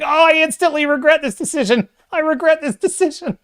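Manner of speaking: high, hammed-up wail of regret; mock regretful victim. Streamer voicing the man bitten by a demon child, mock regret. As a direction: play a bitten man instantly rueing his choice. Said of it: "you can almost just hear him saying".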